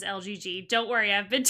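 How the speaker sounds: ordinary talk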